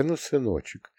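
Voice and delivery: put-on soft, tender murmur to a child; soothing mother. A narrator's reading. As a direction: hush a crying son gently. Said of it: "стала гладить его по голове, приговаривая".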